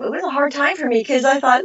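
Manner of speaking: ordinary talk, nothing marked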